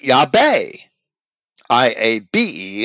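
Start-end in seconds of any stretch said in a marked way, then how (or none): 0.0-0.7 "Yahweh" said Yah-BAY; slow Yahweh with a hard B, stress on the end; Greek-style B pronunciation. Shown as a demonstration. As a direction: swap the W of Yahweh for a B. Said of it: "having no V sound, in Greek would write".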